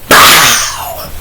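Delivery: long drawn-out "pow" sound effect; imitated impact. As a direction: stretch the blow into one big burst. Mimics the stick striking.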